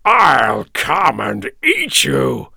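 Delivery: loud, slow troll threat; drawn-out monster yell; screaming troll. A narrator's reading. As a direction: bellow it slowly as an enraged troll. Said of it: "screamed the troll".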